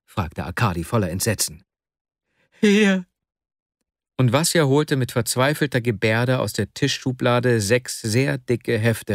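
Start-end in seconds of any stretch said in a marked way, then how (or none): 2.6-3.1 higher, louder despairing outburst. Acted. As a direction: thrust it at him in despair. Wasja in despair.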